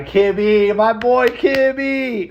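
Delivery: high, drawn-out holler for a buddy; hyped shout-out. Real, not acted. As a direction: belt the name out, stretching it and climbing high. Exuberant shout-out to his friend.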